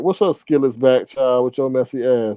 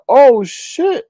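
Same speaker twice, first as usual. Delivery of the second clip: high-pitched startled reaction; retold shock; 10 semitones higher, 4 dB louder than usual. Host reliving hearing the supposed news, startled. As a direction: re-enact a sudden jolt of surprise.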